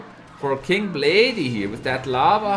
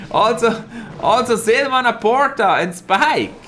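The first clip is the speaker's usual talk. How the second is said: higher, louder, laughing as he reads names; laughing excitement; 6 semitones higher, 5 dB louder than usual. Real, not acted. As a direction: read the names through happy laughter. More viewers join in.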